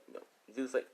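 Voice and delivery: usual speaking voice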